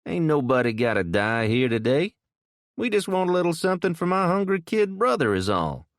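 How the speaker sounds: a hint of a cocky young tough; young male voice. A narrator's reading. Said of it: "a young male voice said".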